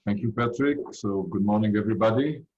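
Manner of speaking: usual speaking voice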